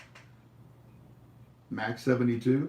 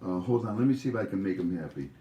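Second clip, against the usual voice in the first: normal talking voice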